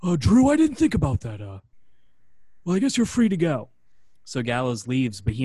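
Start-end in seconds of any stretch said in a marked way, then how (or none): 0.0-3.7 meek backpedaling boss. Retold or imitated. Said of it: "And Johnny Ace goes".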